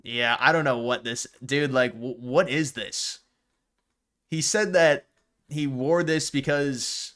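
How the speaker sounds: ordinary talk, nothing marked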